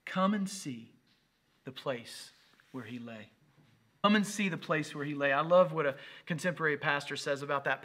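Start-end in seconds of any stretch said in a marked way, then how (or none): 0.1-3.3 slow, pausing, fading away at the end; solemn reading. Acted. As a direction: let the invitation drift into hush. Scripture line, then repeated plainly.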